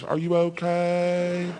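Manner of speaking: high, slow, drawn-out angelic concern; tender. Acted. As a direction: lean in with dreamy mock tenderness. Wife leans in like movie angel.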